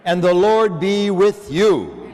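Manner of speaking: loud, sung-out opening blessing over music; intoned liturgy. Acted. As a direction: proclaim the blessing to the whole church.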